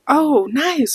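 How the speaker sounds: high, swooping friendly reaction; retold delighted little response; pleasantly surprised. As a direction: imitate someone pleasantly impressed. Acting out a friendly reply.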